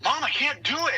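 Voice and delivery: put-on high, whiny kid's plea; frustrated little boy. Son stuck on his jacket zipper complains to mom, high whiny kid voice. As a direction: whine it like a small boy who gives up. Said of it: "she's like".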